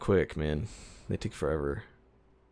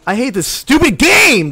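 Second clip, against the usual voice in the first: loud, higher, slow angry yell; frustrated yell; 12 semitones higher, 10 dB louder than usual. Real, not acted. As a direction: let the anger out at the game. Raging at losing.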